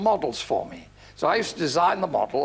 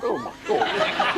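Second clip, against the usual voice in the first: higher, drawn-out mock dismay; mock shocked reaction; 7 semitones higher, slower than usual. Dinner guests hearing the slip, mock horror. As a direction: react as a scandalised dinner table. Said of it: "And we all went".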